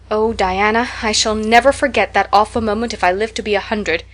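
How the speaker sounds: usual speaking voice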